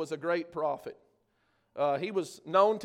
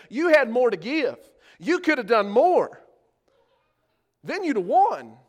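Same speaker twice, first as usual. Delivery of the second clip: loud rebuke; 3 semitones higher, 5 dB louder than usual. Acted. Preacher voicing Elisha's rebuke.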